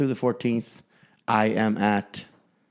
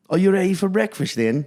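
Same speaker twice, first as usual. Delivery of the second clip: bad Australian accent sliding into Scottish; high mock-Australian, almost Scottish; terrible Australian accent; 7 semitones higher, 4 dB louder than usual. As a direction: botch an Australian accent cheerfully. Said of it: "It's almost Scottish".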